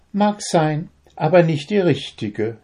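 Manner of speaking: spöttisch und herablassend; contemptuous. A narrator's reading. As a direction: look down on him as you say it. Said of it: "sagte Gori verächtlich".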